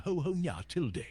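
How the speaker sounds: put-on high-pitched, sparkling VTuber girl voice; kawaii VTuber girl. Shown as a demonstration. Santa doing a requested VTuber impression, high, sparkling kawaii voice. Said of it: "impression of, like, a super kawaii".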